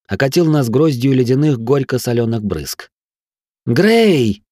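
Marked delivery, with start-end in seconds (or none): 3.7-4.4 high call over the wind; shouting. A narrator's reading. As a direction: call his name out. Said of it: "крикнула Арахна".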